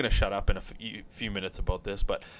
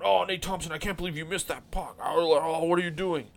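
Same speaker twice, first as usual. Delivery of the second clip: pitched up, mocking a moaning fan; mock whiny fan; 6 semitones higher than usual. Host mocking fans who panic over a miss, higher whiny voice. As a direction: whine like an outraged fan over a missed net. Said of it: "Why are we going".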